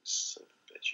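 mock-outraged whisper; hushed, whispered fake accusation; whispered curse. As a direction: curse under your breath in mock disbelief. Mock outrage at being contradicted.

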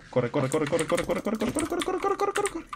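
real building panic; rising panic. Real, not acted. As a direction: chant that climbs to a very high panicked pitch.

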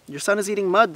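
high, loud accusation; children's loud tattling cry; accusing cry. A narrator's reading. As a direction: tell on him, pitch well up. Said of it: "they cried, pointing accusingly".

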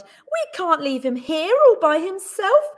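loud, urgent plea; crying out in concern. A narrator's reading. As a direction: plead with concern, starting high. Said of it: "she cried".

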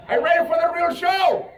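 loud, high showman call; rally the audience; hype shout. Acted. Hyping crowd.

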